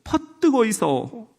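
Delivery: Gyeongsang accent; lilting Gyeongsang greeting; Gyeongsang dialect. Shown as a demonstration. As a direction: welcome them like a Gyeongsang local. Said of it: "경상도는".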